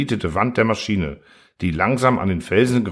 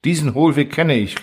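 higher-pitched, lightly excited call; sudden exclamation; 6 semitones higher than usual. A narrator's reading. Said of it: "rief Clapautius plötzlich". (plötzlich ausgerufen)

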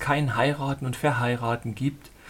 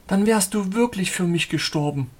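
heroine's wonder; 5 semitones higher than usual. Acted. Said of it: "sagte sie".

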